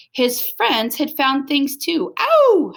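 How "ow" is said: very high, loud joyful howl; dog howling with speed and joy. Acted. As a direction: throw your head back and howl. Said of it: "howled Stanley".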